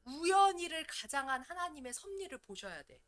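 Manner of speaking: ordinary talk, nothing marked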